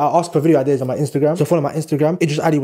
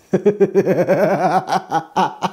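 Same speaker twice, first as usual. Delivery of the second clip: long wheezing laugh; helpless drawn-out laugh. Real, not acted. Vlogger cracking up in the kitchen, helpless laugh.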